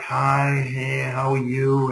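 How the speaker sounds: slow, low, drawn-out timid hello; unsure, low-energy mumbled greeting; meek greeting. Shown as a demonstration. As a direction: greet them as if hiding back. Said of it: "If you're walking around, you're like".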